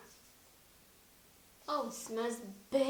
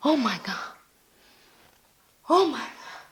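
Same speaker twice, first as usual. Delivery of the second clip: louder, rising oh my God in delight; delighted gasp; 9 dB louder than usual. Real, not acted. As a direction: react to a gorgeous smell. Smelling the face mask.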